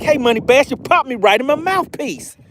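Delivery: high squeaky fish voice; voicing the fish. Host voicing the hooked bass talking back, high and playful. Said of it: "It's like".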